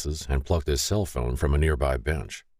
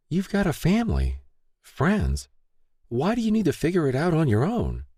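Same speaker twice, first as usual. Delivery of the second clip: put-on much higher, earnest young woman; 12 semitones higher than usual. A narrator's reading. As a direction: voice her as a sincere young woman. Lay voiced, much higher.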